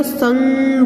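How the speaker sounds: संग held long on the "ng"; ringing, drawn-out nasal in संग; nasal anusvar demo. Shown as a demonstration. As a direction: stretch संग through the nose. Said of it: "हम नासिका द्वारा इसका उच्चारण".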